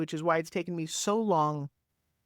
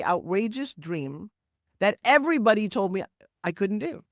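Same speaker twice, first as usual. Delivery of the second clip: usual speaking voice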